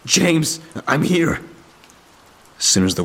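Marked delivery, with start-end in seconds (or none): 0.0-1.4 shout. A narrator's reading. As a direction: yell out defiantly to a friend. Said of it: "I shouted".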